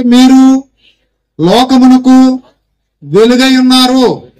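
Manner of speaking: very slow, loud, word by word; loud slow proclamation. Shown as a demonstration. Preacher leads the crowd in saying the verse together, loud and very slow. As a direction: drag each word out so everyone follows. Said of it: "గట్టిగా చెప్పండి నాతో పాటు".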